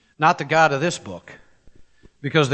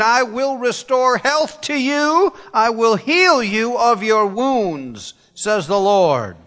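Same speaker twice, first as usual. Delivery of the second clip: impassioned sermon; 8 semitones higher, 5 dB louder than usual. Real, not acted. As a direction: lift the verse up and linger on it. Preaching, slow scripture read.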